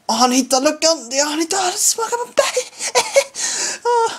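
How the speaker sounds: building excitement. Real, not acted. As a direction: rise with the chance. Friend about to score.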